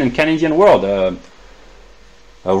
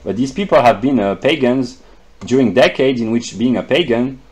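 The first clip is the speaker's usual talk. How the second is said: ordinary delivery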